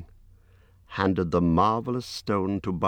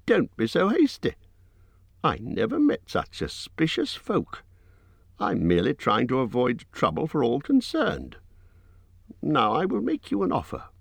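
higher, squeaky hobbit protesting; 7 semitones higher than usual. A narrator's reading. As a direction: squeak it out, a little flustered. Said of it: "squeaked Bilbo".

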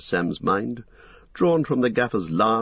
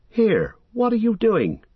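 loud, high alarmed shout; startled shout at a shape in the dark; sharp, suspicious call, higher and louder; cry out; 5 dB louder than usual. A narrator's reading. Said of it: "cried Sam".

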